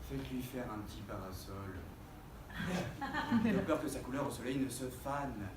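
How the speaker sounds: soft, low, gentle concern; tender. Acted.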